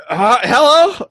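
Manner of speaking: high, loud startled greeting; a sudden squeaky hello; surprised hello. Retold or imitated. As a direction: act caught off guard and puzzled. Awkward greeting retold.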